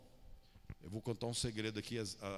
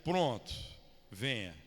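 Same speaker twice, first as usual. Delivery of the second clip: put-on Bahian accent; 4 dB louder, slower than usual. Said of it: "Evangelho segundo o baiano".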